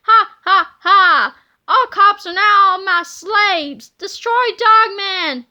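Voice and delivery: loud, slow villain cackle and order; evil laugh. Acted. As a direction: gloat and command. Petey triumphs, orders attack.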